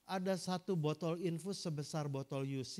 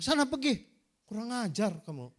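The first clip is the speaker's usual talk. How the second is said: loud, higher angry shout to get out; angry dismissal; 5 semitones higher, 7 dB louder than usual. Retold or imitated. Pastor ordering the students out, loud and angry. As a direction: drive them out of the room.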